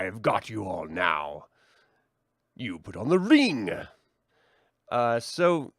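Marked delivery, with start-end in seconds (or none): 0.0-3.7 gloating mustache-twirling villain voice; evil villain. Acted. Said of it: "twisting his evil curled mustache".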